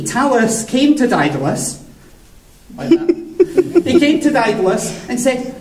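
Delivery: plain reading voice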